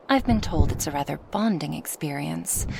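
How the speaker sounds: ordinary delivery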